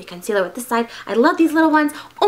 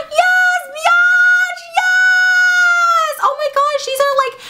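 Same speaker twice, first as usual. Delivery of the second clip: high, loud, drawn-out shrieks of delight; real excited squeal; 6 dB louder, much slower than usual. Real, not acted. Vlogger thrilled by teeth whitening products, excited squeal. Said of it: "I get really excited about teeth whitening products".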